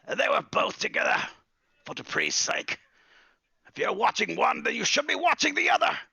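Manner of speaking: put-on cranky old wizard, voice climbing; scolding wizard.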